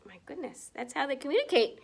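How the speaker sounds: ordinary delivery, nothing marked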